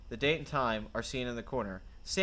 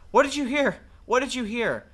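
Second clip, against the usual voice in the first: excited; 10 semitones higher, 7 dB louder than usual. Acted. Eager prisoner asks for news.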